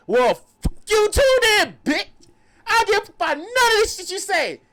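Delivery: hammed-up high, loud angry rant; going off. Storyteller imitating homeless man cursing a girl, going off. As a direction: blow up at her, stretched-out insults at top volume. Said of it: "Like, he's going off".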